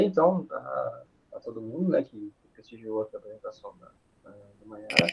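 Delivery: ordinary talk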